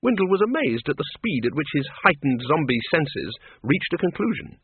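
plain reading voice

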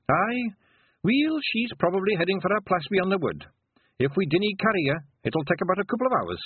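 high-pitched little Scottish pixie; Scottish Feegle. Acted. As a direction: pipe up in a high Scots voice. Rob, a Feegle, answers Tiffany.

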